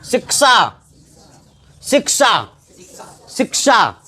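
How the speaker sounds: शिक्षा sounded out as "sik-sha"; teacher's deliberate model of शिक्षा; careful word demo. Shown as a demonstration. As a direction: isolate शिक्षा, distinct क-ष cluster, each time. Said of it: "क्या है ये?".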